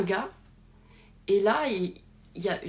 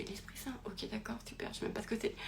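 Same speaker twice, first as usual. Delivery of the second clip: bewildered mumble; 3 semitones higher, 12 dB quieter than usual. Retold or imitated. Retold bewildered reply to priest.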